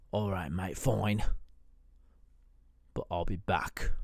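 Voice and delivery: lightly British, grudging; British roommate. Acted. Roommate grumbling in a British accent as he leaves. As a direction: give in with a sulky threat. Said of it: "why he talks like that".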